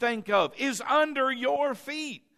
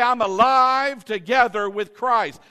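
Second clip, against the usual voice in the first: louder declaration; 8 dB louder than usual. Shown as a demonstration.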